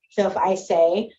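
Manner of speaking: ordinary talk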